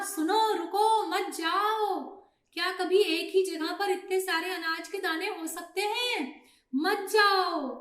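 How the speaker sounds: storybook alarm, pleading and insistent; urgent warning. Acted. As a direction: stop the flock before it flies off. Old pigeon warns flock to stop.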